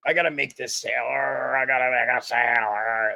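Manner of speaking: hammed-up frantic salesman, words smearing together; mock a sales-obsessed rep, slurring into noise; mock desperate salesman. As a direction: caricature of a pushy seller. Mocking desperate salespeople after "stop with.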